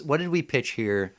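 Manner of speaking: normal talking voice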